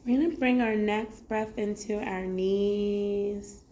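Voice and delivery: ordinary talk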